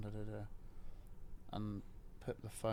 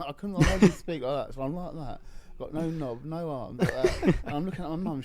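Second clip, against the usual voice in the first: laughing; 6 semitones higher, 10 dB louder than usual. Real, not acted. Laughing retelling ambulance.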